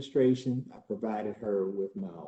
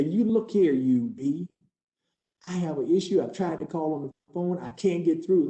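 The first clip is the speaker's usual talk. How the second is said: raised, heated demand, rushing toward the end; angry tenant outburst; 3 semitones higher, faster than usual. Retold or imitated. Tenant off his meds yelling at the leasing agent: angry, raised voice. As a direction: slam the desk and tell her off. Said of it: "I'm real irritated".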